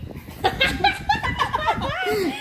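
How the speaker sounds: sudden high squealing laugh; laughing burst. Real, not acted. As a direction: burst out laughing while being tickled. Being tickled during procedure.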